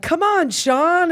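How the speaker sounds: high, drawn-out coaxing call; mock a beau calling from the water; imitated coaxing man. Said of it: "but him to be like".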